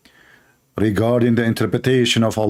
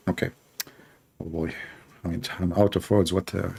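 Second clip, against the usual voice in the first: much softer and lower, at a loss; soft, lowered, defeated murmur; deflated; 6 semitones lower, 8 dB quieter, faster than usual. Real, not acted. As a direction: trail off, out of words. Out of words, deflated.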